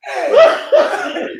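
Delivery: high, laughing call-out; heckling teammate, half-laughing; mock heckle. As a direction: clown on the dingy jersey.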